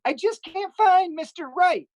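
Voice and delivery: high, slow, breathy-free falsetto whine; mocking high-pitched lament; mock single woman. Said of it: "They're like".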